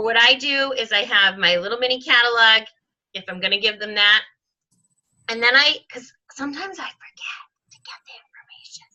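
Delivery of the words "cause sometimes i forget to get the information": a breathy side note to the viewer; whispered aside. Real, not acted. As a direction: drop to a whisper for a quick confession. Aside about forgetting contact info.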